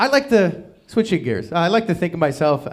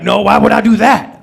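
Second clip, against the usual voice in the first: loud, higher Southern grouch voice; Oscar the Grouch; 5 semitones higher, 8 dB louder than usual. Retold or imitated. Wife denying taking the license plates, grouchy Southern mock voice. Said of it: "made her sound like a Southern Oscar the Grouch".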